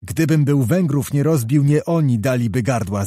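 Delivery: ordinary delivery, nothing marked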